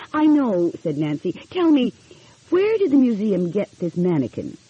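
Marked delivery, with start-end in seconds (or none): 0.1-0.7 lightly raised youthful voice; a hint of eager young woman. Nancy's voice in story. 1.5-4.6 lightly raised youthful voice; a hint of eager young woman. Nancy's eager questions.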